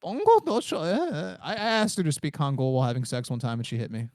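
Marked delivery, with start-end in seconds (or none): 0.0-1.4 high mock-Korean drone; sleepy Korean impression. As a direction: imitate sleepy-sounding Korean speech in a higher voice. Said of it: "the Korean accent".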